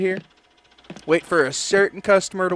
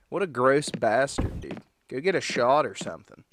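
ordinary talk, nothing marked

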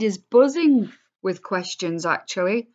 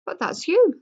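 much higher and louder, delighted surprise; surprised recognition; 12 semitones higher, 5 dB louder than usual. Acted. George points at the old photo, surprised. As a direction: spot him in the photo with a start.

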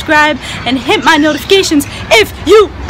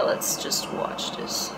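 hushed whisper; 6 semitones lower, 16 dB quieter, slower than usual. Real, not acted. Hushed before starting the video.

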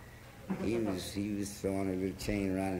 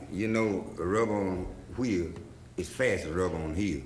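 ordinary delivery, nothing marked

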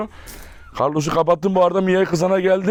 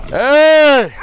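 long, high, loud "hey"; commanding shout; 9 semitones higher, 8 dB louder than usual. Real, not acted. Owner breaking up a dog quarrel, loud high shout. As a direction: shout to stop the dogs.

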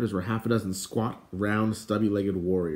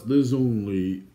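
slow, testy royal correction; drawn-out irritable put-down; snapping; 4 dB louder, much slower than usual. Acted. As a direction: cut in crossly as an irritable king. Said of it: "King Edeleg snapped".

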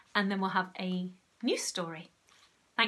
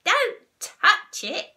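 high, slow, loud warning; urgent high-pitched order; warning cry; 11 semitones higher, 13 dB louder, much slower than usual. A narrator's reading. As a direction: cry it out, stretching the words. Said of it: "cried Missus Twit".

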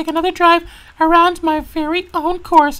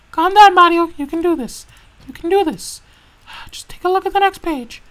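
ordinary delivery

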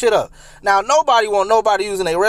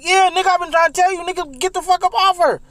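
exasperated; 9 semitones higher than usual. Retold or imitated. Friend's frantic told-you-so.

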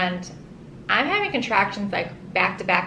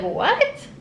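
much higher startled what; shocked what; 12 semitones higher than usual. Retold or imitated. To the doctor who says he pooped, a high startled what. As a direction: jolt of disbelief.

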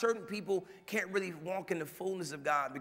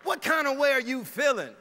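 incredulous laughing question; 7 semitones higher, 5 dB louder than usual. Real, not acted. Preacher mocks trendy phrase.

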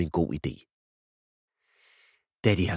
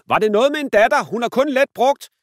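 shouting; 8 dB louder than usual. Acted. Dad teasingly selling his daughter, loud market cry. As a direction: hawk it like a market trader. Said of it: "råber han til en dame".

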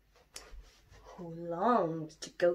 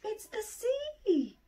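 higher, slow excited call; excited cry; 14 semitones higher, slower than usual. Acted. Little Bear thinks the lake is the sea, high excited cry. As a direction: cry out with delight. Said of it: "shouted Little Bear with an excited cry".

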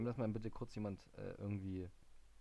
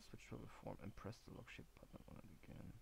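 whispered reading; 9 semitones lower, 16 dB quieter than usual. Real, not acted. Reads hint under breath.

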